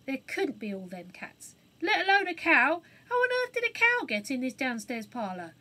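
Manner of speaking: a hint of a bewildered cockney; lightly voiced rough burglar. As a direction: wail in disbelief at the cats.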